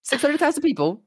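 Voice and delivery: high-pitched, disbelieving outburst; incredulous. Real, not acted. Streamer repeats queue size to friend, incredulous. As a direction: repeat the figure in squeaky disbelief.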